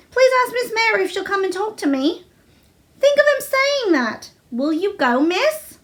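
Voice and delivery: a hint of a lively Yorkshire maid. A narrator's reading. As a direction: gossip eagerly to the young miss.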